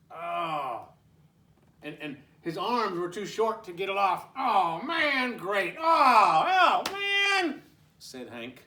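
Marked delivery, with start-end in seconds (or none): 4.3-7.6 1 frustrated groan. Acted. Cup stuck to face.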